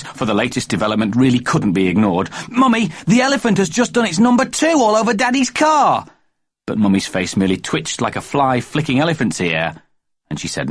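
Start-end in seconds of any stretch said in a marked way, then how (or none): 2.5-6.0 put-on high, loud excited boy; excited child voice. A narrator's reading. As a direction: blurt it like an excited kid.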